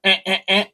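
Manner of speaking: high, short crow-call imitation; crow squawk. Crow scolding from the limb, imitated squawks. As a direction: mimic a crow squawking in threes. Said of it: "She said".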